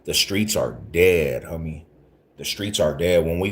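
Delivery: usual speaking voice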